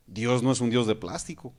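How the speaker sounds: ordinary talk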